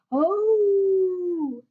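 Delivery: high, long howl like a wolf cub. A narrator's reading. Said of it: "said Alfie".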